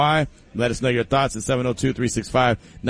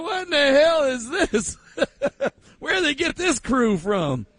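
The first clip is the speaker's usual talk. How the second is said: much higher, incredulous and cracking up; mock bewildered crowd; 11 semitones higher, slower than usual. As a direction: play the baffled onlooker while laughing. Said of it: "They would look at us and be like".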